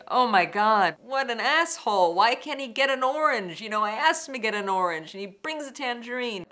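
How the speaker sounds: loud, high-pitched indignant rant; fed up and incredulous, at full volume; exasperated outburst. Retold or imitated. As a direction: rail in furious disbelief, voice jumping up. Acts out her angry realization.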